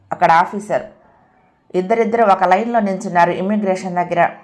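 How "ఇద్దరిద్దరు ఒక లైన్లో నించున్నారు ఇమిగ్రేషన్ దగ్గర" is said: ordinary delivery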